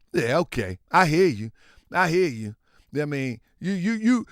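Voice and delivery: usual speaking voice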